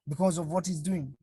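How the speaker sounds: ordinary talk, nothing marked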